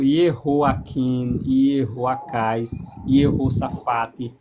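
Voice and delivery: "Yehoaquim, Yehoacás, Yehoshafate" drawn out slowly; careful pronunciation. Shown as a demonstration. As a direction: lay out each Yeho- name slowly. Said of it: "Você tem a pronúncia Yehô". (nomes pronunciados devagar, com "Yeho" bem marcado)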